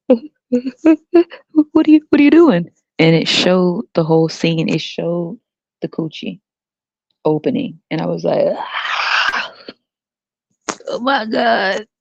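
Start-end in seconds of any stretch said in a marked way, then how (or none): 0.1-2.7 high, loud, halting alarm; horrified shock. Retold or imitated. Kid shocked by birth on TV, high loud alarm. 8.6-9.5 breathy drawn-out scream; mock horrified scream. Kid seeing the birth on TV, airy scream. 10.9-11.9 high-pitched appalled reaction; mock horror, pitched up; acted horror. Kid horrified by birth show, high dismay.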